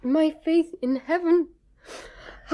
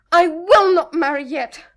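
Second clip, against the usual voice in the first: tearful defiance; 9 dB louder than usual. Acted. Juliet defies her mother, crying.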